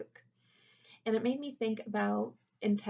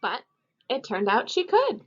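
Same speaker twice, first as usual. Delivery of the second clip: lively read-aloud for a class, louder than her chat; storybook reading; 8 dB louder than usual. A narrator's reading. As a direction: read it to the kids with bright expression. Teacher reads picture book aloud.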